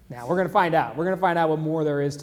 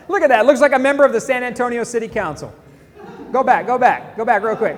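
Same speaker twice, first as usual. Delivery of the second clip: amused, raised; 4 semitones higher, 5 dB louder than usual. Real, not acted. Host mocking council lookalike.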